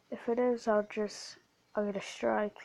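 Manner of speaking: ordinary talk, nothing marked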